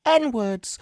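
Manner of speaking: very high, squeaky retort; high-pitched imitation. Teasing a friend about a clip, high-pitched imitation. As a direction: pitch it right up, mimicking. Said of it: "so high pitched".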